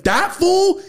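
mock amazed. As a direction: squeal it in disbelief. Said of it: "I'm like".

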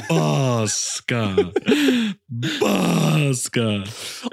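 drawn-out mock cat call; odd Finnish cat. Cat answering its Finnish owner, drawn-out cat voice. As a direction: intone it like a strange cat. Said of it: "And he's like".

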